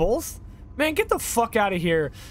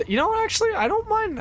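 high squeaky voice; faster than usual. Pretend. Sudden silly high voice.

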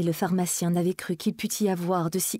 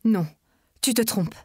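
firm, raised denial; strong voice; 4 semitones higher than usual. A narrator's reading. Emma contradicts Charles, firm voice. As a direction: say it firmly. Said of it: "d'une voix forte".

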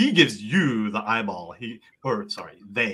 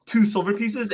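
higher-pitched courteous bartender; bartender figure; 7 semitones higher than usual. Acted. Bartender charging a customer for a drink, high and courteous. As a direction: play the bartender naming the price. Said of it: "He goes".

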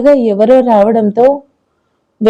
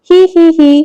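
gleeful giggle; 6 semitones higher, faster than usual. Acted. Girl giggles after winning.

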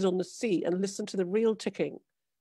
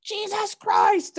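high, loud, slow cry of disbelief; shocked exclamation; 12 semitones higher, 5 dB louder, slower than usual. A narrator's reading. Fisherman exclaiming as the mermaid hits the water, high and loud. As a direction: blurt it out in horror. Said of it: "exclaimed Thomas Clayson".

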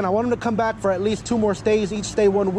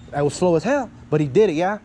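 ordinary talk

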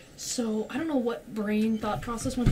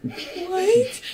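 high, loud disbelieving "What?"; shocked what; 11 semitones higher, 9 dB louder than usual. Retold or imitated. Shocked reaction in retold scene.

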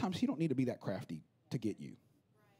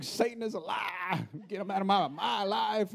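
higher, slow, dramatic rebuke; mock rebuke; 7 semitones higher, 3 dB louder, slower than usual. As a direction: ham up a believer casting out Satan. Voicing churchgoer rebuking Satan.

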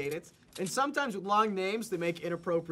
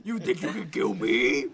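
menacing villain taunt; dark lord; 5 semitones lower, 4 dB louder than usual. Pretend. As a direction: taunt like an evil overlord. Playing the villain snowman.

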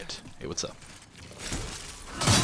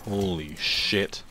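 awed murmur; 4 semitones lower, 7 dB quieter than usual. Real, not acted. Awed reaction.

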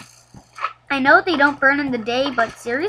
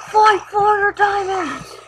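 normal talking voice

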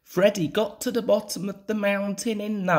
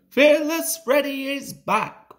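loud, high, proud announcement; puffing engine; 9 semitones higher, 5 dB louder than usual. A narrator's reading. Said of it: "puffed Freddy".

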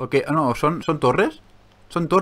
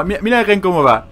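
raised, louder amused exclamation; amused excitement; 5 semitones higher, 6 dB louder than usual. Real, not acted. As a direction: point out something ridiculous to viewers. Amused by glitching character.

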